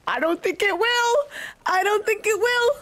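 high singled-out taunting at the screen; excited high-pitched jeer; gleeful taunt. Real, not acted. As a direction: crow it high, rising at the end. Gleeful at villain's useless apology.

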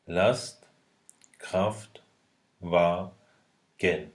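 Last, Kraft, Wa, Gen as separate beats; syllable by syllable. Shown as a demonstration. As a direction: pause between each syllable. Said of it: "Du sprichst alle Silben des Wortes deutlich".

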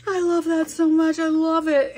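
high, loud gushing friend; high excited imitation of friend; imitating friend. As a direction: gush like the friend who loves them. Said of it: "she's like".

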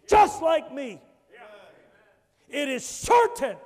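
loud, urgent preacher's warning; full force preaching. Acted. Preacher warning the church that all face judgment, loud. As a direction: drive it home to every listener.

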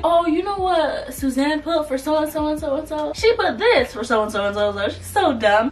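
hammed-up tattling student; mimicked tattling classmate. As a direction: run and tell on someone, mockingly. Tattling classmate voiced in a story.

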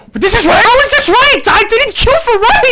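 high, loud disbelieving shout; shocked outrage. Real, not acted. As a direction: yell in disbelief at the screen. Shocked to find ranked queue.

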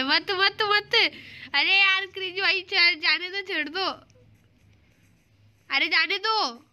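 high, whiny begging; pleading. Real, not acted. Player begs friend to let him go, whiny pleading. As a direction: beg to be let off.

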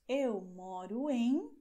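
slow, clear teacher model with rising em; patient classroom demo; slow model phrase. Shown as a demonstration. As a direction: say it slowly for learners. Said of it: "nós diremos".

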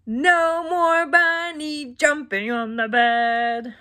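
slow, lightly voiced stern doctor. Doctor scolding the bunnies, slow and a touch stern. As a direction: wag a finger slowly, a hint of a stern doctor. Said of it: "the doctor said".

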